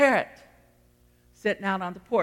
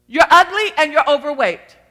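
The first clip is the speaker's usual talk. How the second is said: loud, high parrot insult; rude parrot; 6 semitones higher, 10 dB louder than usual. Acted. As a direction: squawk it out as a rude bird. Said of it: "he didn't say it in a nice word that way".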